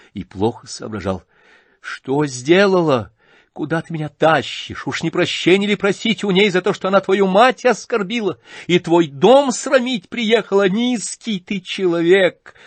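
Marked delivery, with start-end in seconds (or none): 1.8-12.5 high, loud, triumphant yell; defiant shouting. Acted. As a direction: hurl it at her brother defiantly. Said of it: "крикнула опять Варя, торжествуя".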